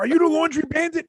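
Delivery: high mock accusation. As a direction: put on a high silly voice asking. Mocking voice of restaurant staff.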